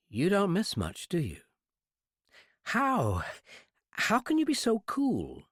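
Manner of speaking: put-on high, slow wondering young woman; amazed girl. Girl marvels at her captor's calm. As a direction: marvel at his nerve.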